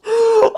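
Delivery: sudden loud high gasp; sharp loud gasp. Real, not acted. Fan stunned by the play, sharp gasp. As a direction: gasp in shock.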